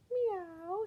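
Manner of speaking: high mewing cat; cat meow. Acted. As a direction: mew it like a hopeful cat. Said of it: "said the cat".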